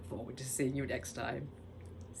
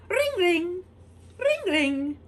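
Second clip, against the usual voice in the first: phone ring; 10 dB louder, slower than usual. Pretend. Phone ringing again.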